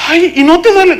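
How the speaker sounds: high-pitched, whining question; mock plaintive wail. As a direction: imitate the distraught relative, high-voiced. Mimics the wailing relative questioning him. (pregunta quejumbrosa y aguda, imitada)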